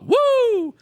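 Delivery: very high shouted woo, hammed up. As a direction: yelp it like a bad impression. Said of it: "Listen to this".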